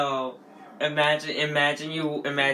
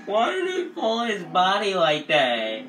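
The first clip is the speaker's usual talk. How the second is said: disbelieving; 8 semitones higher, 6 dB louder, slower than usual. Real, not acted. Slow disbelieving question.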